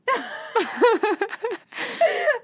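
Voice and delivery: high squealing laugh; burst of laughter. Real, not acted. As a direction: laugh out loud, high and bright. Laughing at friend's joke.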